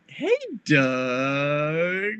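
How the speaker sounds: long drawn-out, rising mock-mom hello; imitating a mom's greeting. Retelling her mom answering the phone, drawn-out mimicked greeting. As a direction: stretch the name upward, mimicking her. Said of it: "that's exactly how she said it".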